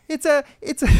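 high, faltering mock Italian accent; exaggerated Italian accent, false start; Jared Leto. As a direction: attempt a cartoon Italian accent and give up. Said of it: "I'm doing Jared Leto".

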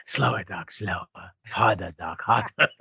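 low, soft mock-breathless pleading; mock passionate pleas. Co-host as the actress in bed with Doc, hushed mock pleading.

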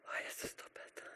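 barely whispered narration; hushed awe. A narrator's reading. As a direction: drop to a breath of a whisper. Hushed climax after the shout.

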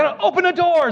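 high-pitched urgent announcement; frantic reply. Retold or imitated. Husband with the mouse answers his wife, frantically.

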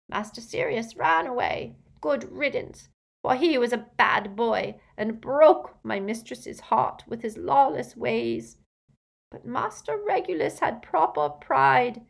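plaintive elf recollection; muffled but distinct. A narrator's reading. Elf tells of his two masters, muffled but distinct. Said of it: "his voice was muffled, but quite distinct".

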